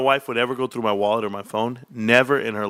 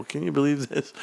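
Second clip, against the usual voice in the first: ordinary delivery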